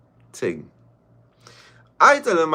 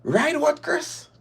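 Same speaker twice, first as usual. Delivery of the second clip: much higher incredulous retort; high squeak of disbelief; slower than usual. Retold or imitated. Caller repeating her retort to Chris, much higher.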